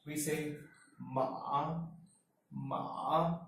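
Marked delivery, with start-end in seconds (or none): 1.0-1.8 ma dipping down, then rising; third tone. Shown as a demonstration. Teacher demonstrating the third tone, falling-then-rising ma. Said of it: "first falls and then rises". 2.5-3.4 ma falling, then lifting; third tone. Shown as a demonstration. Teacher repeating the third tone, falling-then-rising ma. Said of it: "first falls and then rises".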